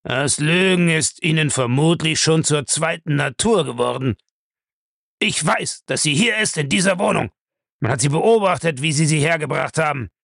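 high-pitched, pressing accuser; angry Bellamy, accusing. Acted. Bellamy accuses Julius of lying, indignant.

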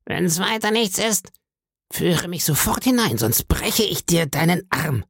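high, cold mocking beggar voice; mocking threat. A narrator's reading. Blind beggar threatening to break the boy's arm, mocking. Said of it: "sagte er spöttisch".

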